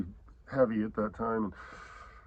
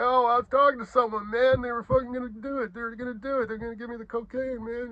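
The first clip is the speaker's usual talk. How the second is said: much higher, louder excuse-making whine; whiny drunk buddy; 12 semitones higher, 4 dB louder than usual. Retold or imitated. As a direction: whine like a drunk friend who missed out. Drunk friend whining, retold.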